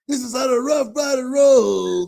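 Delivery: loud, raspy rap shout. Retold or imitated. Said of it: "D M X voice".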